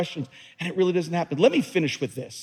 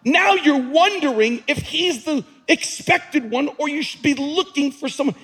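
exasperated; 8 semitones higher, 3 dB louder than usual. Retold or imitated. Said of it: "I'm going".

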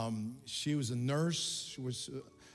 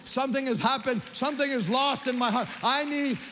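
imitating a bishop's high, urgent confession; impassioned plea; 10 semitones higher, 6 dB louder than usual. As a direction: plead loudly as the repentant bishop, pitch climbing. Bishop begs forgiveness, retold passionately.

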